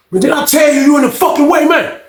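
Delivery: high furious yell; angry shout. Retold or imitated. Old head yelling at OG to move, high shout. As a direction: shout him out of the way.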